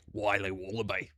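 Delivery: low, soft, badly faked Australian accent; terrible Australian accent. Streamer shows his snack-packaging Australian accent, low and soft. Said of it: "a terrible American Australian accent".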